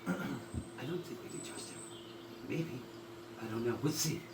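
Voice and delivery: soft, hushed wary voice; quiet pixie voice. Acted. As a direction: murmur like a hidden pixie. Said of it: "you hear voices".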